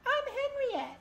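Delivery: high falsetto queen. Acted. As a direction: speak as a gracious queen. Voicing queen in cutscene.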